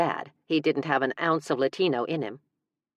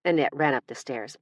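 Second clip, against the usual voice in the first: ordinary delivery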